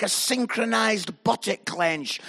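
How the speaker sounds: ordinary talk, nothing marked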